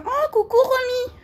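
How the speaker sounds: sing-song high greeting to a pet. Real, not acted. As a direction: coo it slowly and high, like to a small animal. Greeting her animal affectionately.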